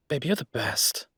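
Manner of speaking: normal talking voice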